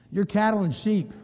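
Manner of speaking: normal talking voice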